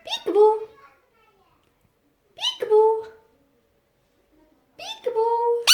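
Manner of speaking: high, loud peekaboo call; sing-song peekaboo. Real, not acted. Owner playing peekaboo with her parrot, high sing-song.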